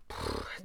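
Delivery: unvoiced puff of contempt; scornful puff. A narrator's reading. Mu scoffing at the other children, with a scornful puff. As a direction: blow out a dismissive "pff".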